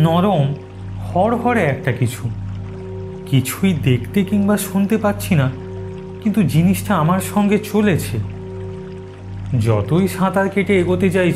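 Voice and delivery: ordinary delivery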